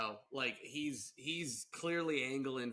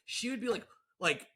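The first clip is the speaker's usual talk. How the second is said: ordinary talk, nothing marked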